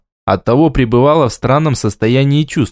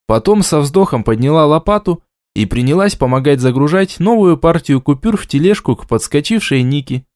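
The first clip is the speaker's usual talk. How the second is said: plain reading voice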